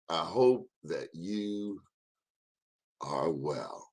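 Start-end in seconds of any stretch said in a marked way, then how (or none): none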